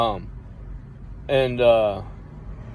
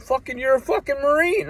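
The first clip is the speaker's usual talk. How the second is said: incredulous, much higher; outraged high retort; 13 semitones higher than usual. Retold or imitated. Scolding the uncle who won't come, outraged high retort. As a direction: throw it at him in disbelief.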